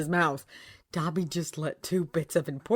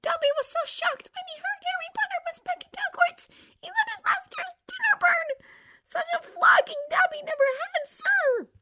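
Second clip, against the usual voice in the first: very high, whispery house-elf squeak; a tiny frantic elf pleading; Dobby voice; 5 dB louder than usual. A narrator's reading. Tearful house elf begging Harry Potter, high and whispery.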